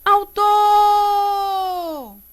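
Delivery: high call with "tor" held very long. Shown as a demonstration. Teacher calls the word to find stress, long high "autooor". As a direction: call "autor" like hailing someone far away. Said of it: "Chama a palavra".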